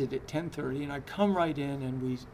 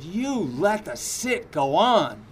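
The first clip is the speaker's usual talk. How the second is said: louder, slow, mock angry accusation; retold angry accusation; 2 semitones higher, 8 dB louder, slower than usual. Furious student accusing the teacher, imitated loud accusation. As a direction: hit each word like you're fed up. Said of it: "she was furious at me".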